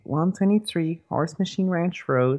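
slow, deliberate dictation; slow and clear. Real, not acted. Repeating the address slowly over a crackly phone. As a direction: spell out the address slowly over a bad line. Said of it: "'cause you're breaking up".

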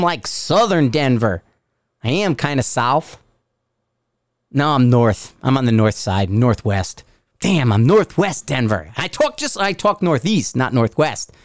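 ordinary talk, nothing marked

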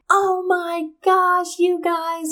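high, drawn-out imitation of startled friends; exasperated surprise. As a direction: mimic them, stretched out and high-pitched. Friends' startled reaction, acted out.

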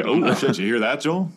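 ordinary talk, nothing marked

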